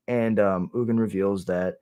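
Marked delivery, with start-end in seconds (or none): none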